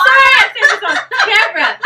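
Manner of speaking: high, loud, embarrassed laughing aside; real laughter breaking into the words; laughing apology. Real, not acted. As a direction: apologise through a burst of laughter. Embarrassed confession amid laughter.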